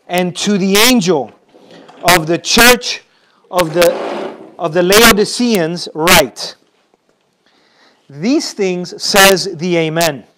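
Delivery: slow, loud solemn reading of scripture. Acted. Pastor reads verse fourteen aloud, slow and solemn. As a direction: proclaim each phrase to the congregation.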